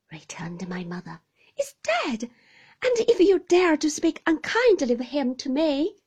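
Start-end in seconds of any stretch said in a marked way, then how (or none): 1.6-5.9 higher, trembling defiance; indignant young widow. Acted. Young widow defends her late husband to the aunt, indignant. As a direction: stand up for him through tears.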